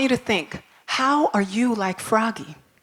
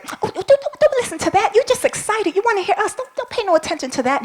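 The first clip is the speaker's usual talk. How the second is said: much higher, pleading bird voice; fussy bird; 11 semitones higher, faster than usual. Acted. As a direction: hastily talk them out of it. Bird urging animals to ignore.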